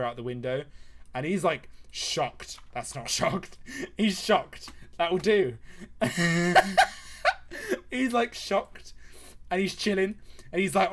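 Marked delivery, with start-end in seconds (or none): 5.6-7.9 real cackling laugh. Real, not acted. Streamer at his badly drawn shocked face, cackling. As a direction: burst of wheezy laughter.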